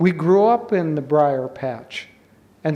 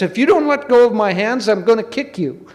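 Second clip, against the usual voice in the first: high, loud, hurried warning; heated mock threat; 6 semitones higher, 6 dB louder, faster than usual. Rabbit with both hands stuck threatens, high and heated. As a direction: rush out an indignant storybook threat.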